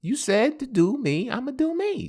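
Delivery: put-on girlfriend voice. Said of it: "She said".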